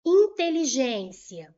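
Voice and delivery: slow, loud "Inteligência"; loud announcing. Shown as a demonstration. Teacher presenting the next example to students, loud announcing. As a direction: put the example word out firmly and loudly.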